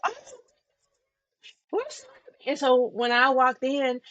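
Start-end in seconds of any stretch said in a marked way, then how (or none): 0.0-2.1 apologetic relatives. Retold or imitated. Relatives apologizing once they knew her.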